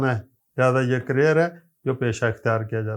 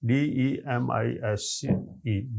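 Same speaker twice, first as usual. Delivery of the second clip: slow, softer letter-by-letter spelling; spelled letter by letter; 3 semitones lower, 7 dB quieter, slower than usual. Shown as a demonstration. Teacher spelling the correct answer for students. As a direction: spell it out slowly, then say the word. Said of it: "دیکھتے ہیں کہ اس میں ہمارا پاس correct choice".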